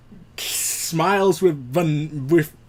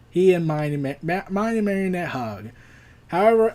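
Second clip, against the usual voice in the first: ordinary talk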